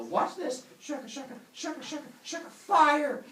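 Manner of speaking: high, eager chant ending loud; excited demonstration. Acted. Humans show villagers the sticks, excited chant. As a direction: chant high, then burst out on fire.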